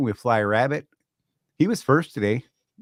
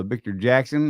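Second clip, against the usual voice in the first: usual speaking voice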